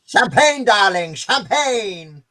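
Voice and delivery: hammed-up glamorous drawl, champagne darling; mock posh socialite. Teasing the guest, mock posh socialite voice. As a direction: play a grand party hostess.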